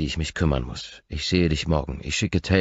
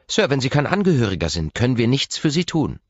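higher, louder nurse voice; a strict receptionist nurse; stern nurse; 10 semitones higher, 6 dB louder than usual. Acted. Reception nurse questioning the visitor, strict.

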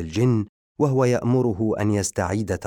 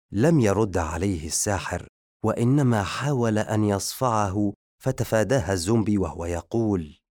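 usual speaking voice

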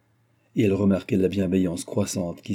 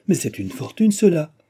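climbing to a higher, louder cry on cela; delighted exclamation; 8 semitones higher, 4 dB louder than usual. A narrator's reading. Maid thrilled by the gift of money, delighted cry. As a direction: burst out in delight. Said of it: "s'écria Nicole ravie".

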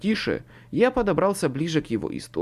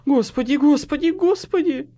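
loud, high, frantic repeats; frightened man babbling in alarm; choked panic; 12 semitones higher, 7 dB louder than usual. A narrator's reading. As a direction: climb higher with each repeat. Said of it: "произнёс Хват перехваченным голосом".

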